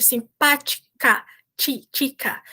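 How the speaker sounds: "simpática" stretched, with a heavy "tchi"; exaggerated ch sound in "simpática" and "tica"; strong Portuguese t. Shown as a demonstration. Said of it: "é muito forte".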